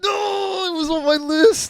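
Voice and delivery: loud, high wail of dismay; playful howl of a stolen pick; anguished protest. Real, not acted. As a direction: draw out the no, crying foul. Co-host took his pick.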